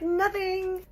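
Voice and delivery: put-on "Nothing." squeaked very high, girlish and teasing; extra coy nothing. Denying to the mohawk guy again, squeaky high and coy. Repeats denial more coyly.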